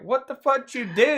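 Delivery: high-pitched startled demand; retold outburst, voice jumping up; incredulous retort. Retold shocked reaction to crying classmate.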